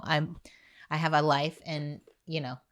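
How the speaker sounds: ordinary talk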